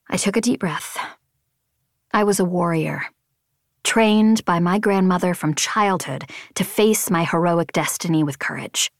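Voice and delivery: ordinary delivery, nothing marked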